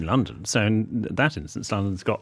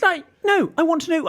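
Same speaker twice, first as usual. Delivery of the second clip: emphatic insist; 4 dB louder than usual. Retold or imitated. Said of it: "my nice way of saying".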